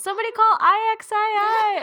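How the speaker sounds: excited mock cry. Mocking the movie line.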